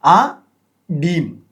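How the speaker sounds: ആ set apart before ഡീം, two clear beats; ആ ഡീം spelled out syllable by syllable; split into syllables. Shown as a demonstration. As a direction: pause between ആ and ഡീം to count them. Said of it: "രണ്ട് syllable".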